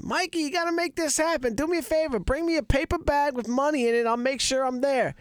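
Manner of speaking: imitating Nick. Nick pushing Mikey for a paper bag of money, in a high hustler voice. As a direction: play a wheeling-dealing buddy asking for cash. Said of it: "I was talking to Nick".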